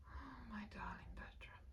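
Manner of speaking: a slow, soft, beaten whisper; defeated whisper. Acted. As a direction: breathe it out, all fight gone. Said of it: "Her voice was a defeated whisper".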